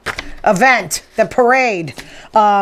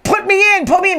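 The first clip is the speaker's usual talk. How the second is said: higher, pleading demand, lightly acted; mock Kelce begging to go back in; imitating an urgent plea; 10 semitones higher than usual. Kelce yelling at his coach, mock urgent plea.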